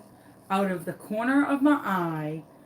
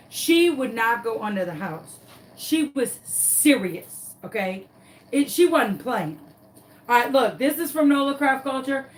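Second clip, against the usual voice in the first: ordinary talk, nothing marked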